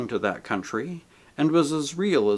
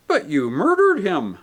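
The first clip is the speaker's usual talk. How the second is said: higher, louder accusation; accusing ghost; 6 semitones higher, 5 dB louder than usual. A narrator's reading. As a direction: fling the charge at him. Voices the big ghost.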